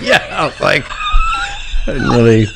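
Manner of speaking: laugh-shouting, voice jumping very high. Real, not acted. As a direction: crack up mid-sentence. Laughing at sister's question.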